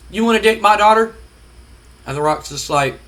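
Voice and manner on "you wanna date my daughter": mock angry challenge. Vince taunting The Rock.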